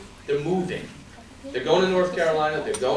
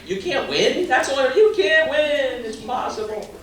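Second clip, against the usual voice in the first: high, scoffing disbelief; mocking the doubters; 10 semitones higher than usual. Candidate mocking critics who said he couldn't win, high scoffing. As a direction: mimic the naysayers, much higher and incredulous. Quoting those who called him nuts.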